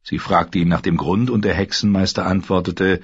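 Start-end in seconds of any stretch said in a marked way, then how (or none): none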